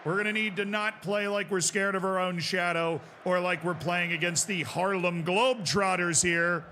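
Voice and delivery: rally the players, raise your voice; halftime pep speech. Acted. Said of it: "halftime team talk to players".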